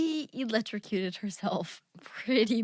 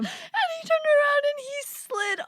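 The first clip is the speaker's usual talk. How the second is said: high, laugh-cracked telling; can't keep the laugh out; laughing through the words; 14 semitones higher than usual. Real, not acted.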